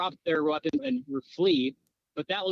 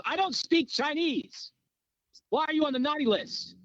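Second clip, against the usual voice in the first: exasperated interrogator; 9 semitones higher than usual. Retold or imitated. Interrogator to a man answering in Chinese, exasperated. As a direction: imitate an interrogator losing patience.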